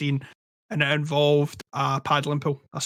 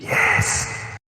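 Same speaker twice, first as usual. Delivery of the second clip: close, airy whisper into the mic; ASMR whisper; 4 dB louder than usual. Pretend. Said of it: "Not like that".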